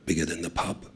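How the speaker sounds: hushed dread. Acted. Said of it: "I lower my voice".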